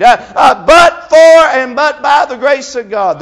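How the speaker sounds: fervent preaching, uh-punctuated and loud; preaching at full force. Acted. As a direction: build the sermon to a near shout.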